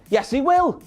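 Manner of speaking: normal talking voice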